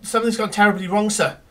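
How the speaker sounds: stammering it out, racing at the end; a terrified servant tripping over words; panicked stammer. Acted.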